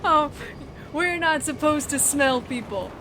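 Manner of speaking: loud, amused, half laughing; excited and amused. Real, not acted. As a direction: protest loudly while still laughing. Player laughs at being scolded.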